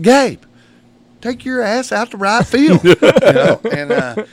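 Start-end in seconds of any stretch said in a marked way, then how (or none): 0.0-2.8 high, loud fed-up order; exasperated yell. Retold or imitated. Coach sends the boy to right field, loud and fed up. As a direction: snap it at him, out of patience.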